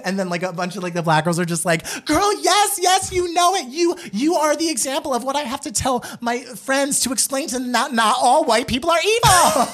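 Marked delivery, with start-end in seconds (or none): 2.1-9.7 very high-pitched, hammed-up cheering on; mock fervent agreement. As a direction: imitate girls agreeing in a squealing high voice.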